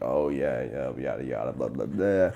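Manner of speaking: low, droning brush-off; mock bored rattle-off, pitched well down; dismissive yada-yada. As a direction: mumble it away in a low, bored drone. Brushing off a boring comment.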